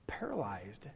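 a hushed, breathless whisper. Real, not acted. Preacher describing frozen Israel, whispered. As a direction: let the word die in a whisper. Whispered to show paralyzing fear.

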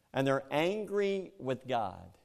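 ordinary delivery

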